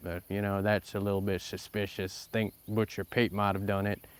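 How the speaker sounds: put-on lightly drawn western cowboy accent. Cowboy partner yarning about the town doctor, in a western accent. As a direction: play an Old West gunslinger in a cowboy accent. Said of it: "Sorry about the accent".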